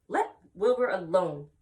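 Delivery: loud, slow scolding; sharp rebuke. Acted. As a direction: snap at the lamb to back off. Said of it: "spoke sharply to the lamb".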